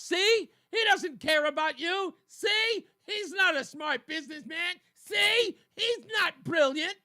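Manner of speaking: high, loud hammed-up insistence; exaggerated "see?" protest; mock outraged critic. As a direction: crow each point at the audience like a tattling sibling. Voices Trump's critics pleading their case.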